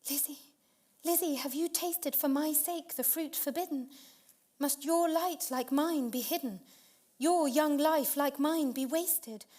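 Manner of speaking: high, loud, desperate pleading; anguished cry. Acted. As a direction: cry out in horror for your sister. Laura's anguished cry to sister.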